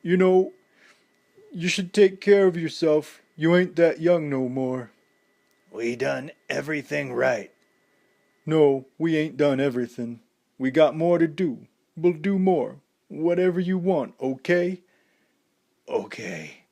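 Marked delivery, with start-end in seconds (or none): 0.0-4.9 higher, louder, holding back tears; strained brave voice; forced steadiness. A narrator's reading. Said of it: "found his voice again". 8.4-14.8 higher, pleading voice near sobbing; choked-up promises; tearful pleading. A narrator's reading. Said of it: "Rocky sobbed".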